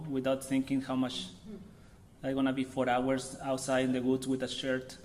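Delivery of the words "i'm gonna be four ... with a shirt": usual speaking voice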